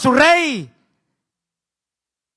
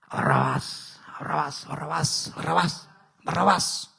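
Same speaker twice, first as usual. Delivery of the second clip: low, rough mock chant of the name; elders urging the crowd; 9 semitones lower, 11 dB quieter than usual. Elders egging the crowd on, low muttered chant. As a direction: egg the crowd on, growling the name. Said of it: "le dijeron a la gente".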